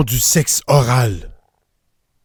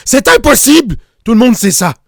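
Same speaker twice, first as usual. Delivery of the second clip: plus aigu, un peu plus fort; s'exclamer, outré et sidéré; indignation incrédule; 7 semitones higher, 4 dB louder than usual. Acted. Outraged disbelief at headless chicken. (exclamation outrée)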